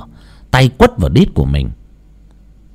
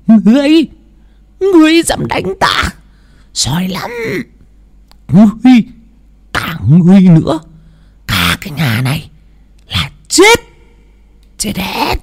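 high eerie voice, spitting threats; the spirit curses the whole family, high and menacing; possessed threatening; 9 semitones higher than usual. Acted. Possessed grandma threatens everyone. (giọng vong nhập đe dọa, the thé)

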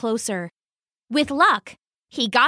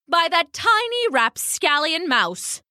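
high, affronted character voice; scandalised dove; 7 semitones higher than usual. Acted. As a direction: denounce the culprit as an affronted bird. Dove outraged at mouse.

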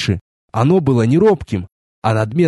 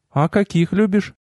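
goddess's higher voice; 5 semitones higher than usual. A narrator's reading. Narrator voices the goddess asking.

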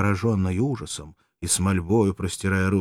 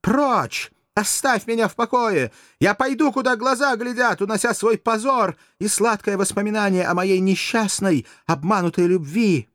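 much higher and louder, desperate; anguished outcry; 14 semitones higher, 5 dB louder than usual. Acted. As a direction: wail it out in wounded pride. Courtesan cries out, wounded.

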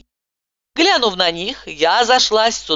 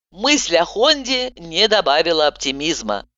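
ordinary delivery, nothing marked

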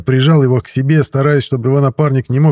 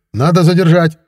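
higher, louder excited urging; perking up; 8 semitones higher, 5 dB louder than usual. A narrator's reading. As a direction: urge the police on eagerly. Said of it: "оживился директор". (оживлённое, громкое требование)

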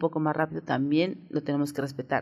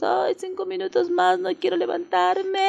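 put-on much higher, whining like a kid who won't get up; sleepy Monday whine; 13 semitones higher than usual. The host plays someone who overslept on Monday, high whiny plea. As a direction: beg for five more minutes in a high whine. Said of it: "se nos pegan las sábanas y decimos".

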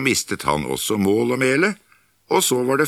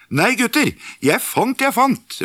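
excited call. Acted. Askeladden calling his find to his brothers, high excited shout. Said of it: "ropte han".

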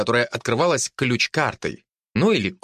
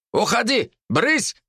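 higher shoo shout; 10 semitones higher, slower than usual. Acted. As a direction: shoo the pig away. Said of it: "крикнул один".

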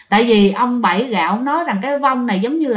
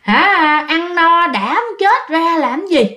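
mocking ghost; 5 semitones higher, much slower than usual. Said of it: "bà cười các bạn".

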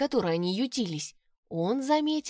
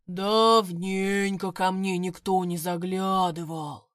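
slow rumbling bog monster voice; булькающий бас водяного; 3 semitones lower, slower than usual. Acted. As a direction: rumble it like a swamp creature. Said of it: "пророкотал хозяин болота". (рокочущий булькающий голос)

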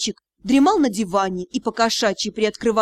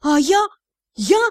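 mildly indignant stammered start; 3 semitones higher, 4 dB louder than usual. A narrator's reading. Captain answering the cat's reproach, indignant. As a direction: cut in, a touch outraged. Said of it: "Верис тоже была возмущена".

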